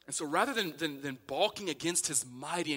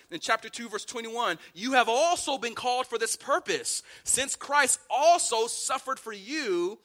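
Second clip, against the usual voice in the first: loud, raised, emphatic sermon delivery; full-force preaching; 7 semitones higher, 8 dB louder than usual. Real, not acted. Preacher pointing the church back to Christ, preaching forcefully. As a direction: preach it hard, driving key words high.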